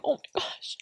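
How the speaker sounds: hushed, breaking whisper of shock; tearful gasp. Retold or imitated. Mother retelling seeing the loss alone, tearful whisper. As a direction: let it catch as the tears come.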